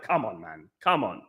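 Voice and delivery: low, soft disappointed groan; mock exasperation. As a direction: sigh it out low and let down. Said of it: "I was like".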